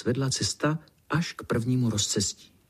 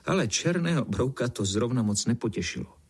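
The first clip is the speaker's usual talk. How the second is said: ordinary delivery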